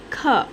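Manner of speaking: kh said alone as a puffed k plus short uh; soft, aspirated kh, cleanly separated; soft kh sound. Shown as a demonstration. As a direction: give kh as an isolated teaching sound. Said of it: "the sound will be soft kh".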